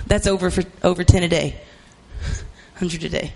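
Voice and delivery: normal talking voice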